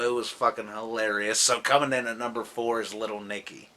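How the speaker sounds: usual speaking voice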